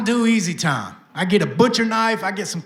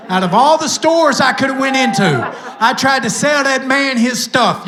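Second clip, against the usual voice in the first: higher, louder laughing punchline; laughing exclaim; 5 semitones higher, 5 dB louder than usual. Real, not acted. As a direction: shout the irony while laughing.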